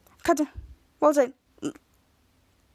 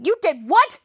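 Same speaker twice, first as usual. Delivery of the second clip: louder, incredulous question; shocked outburst; 3 semitones higher, 5 dB louder, slower than usual. Acted. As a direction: explode in mock disbelief. Acted shock at confession.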